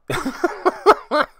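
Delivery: short high giggle at his own mean play; laugh. Real, not acted. Caster laughing at his own cruel play. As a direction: laugh at how awful the move is.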